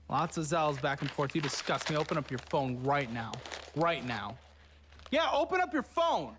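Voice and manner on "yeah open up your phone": high demanding. Real, not acted. Demanding she open phone.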